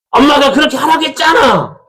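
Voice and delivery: acted child shouting blame at mother; defiant yell. Child blaming mom for what she told them, defiant yell.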